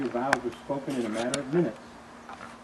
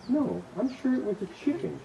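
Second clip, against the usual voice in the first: wife's voice; 12 semitones higher than usual. Acted. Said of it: "she said".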